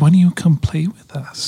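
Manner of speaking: put-on light creepy little girl voice; man doing a woman's voice. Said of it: "dead little girl voice".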